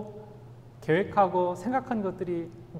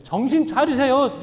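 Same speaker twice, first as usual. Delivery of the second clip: loud and higher, scolding his master; rude sharp rebuke; 8 semitones higher, 9 dB louder than usual. Retold or imitated. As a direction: bark it as if to snap him out of it. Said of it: "조금 더 심한 표현으로 얘기하면". (스승을 꾸짖는 베드로, 크고 높게 쏘아붙이듯)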